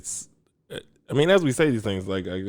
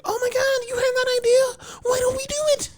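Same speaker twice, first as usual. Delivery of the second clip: very high, squealing excited voice. Retold or imitated. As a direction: gush like a thrilled partner. Voicing excited partner's reaction.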